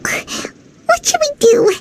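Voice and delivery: slow, high anxious whisper; worried whisper. Acted. Paimon to the Traveler after the Knave leaves, worried whisper. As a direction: ask it under your breath, scared.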